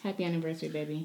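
soft tender anniversary wish; romantic. Real, not acted. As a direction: whisper it lovingly. Wife wishes husband anniversary.

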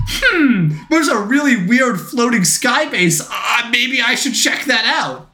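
playfully voicing a puzzled hero; mock Iron Man musing. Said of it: "at no point was he like".

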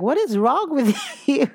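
mock the gym partner cracking up at you; laughing mock disbelief from the workout partner; partner's teasing. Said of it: "My partner was like".

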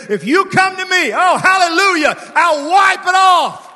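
shouting, big and high, the last words slowed right down; shouted promise. Acted. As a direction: cry it out to the whole church. Preacher shouting Jesus' promise.

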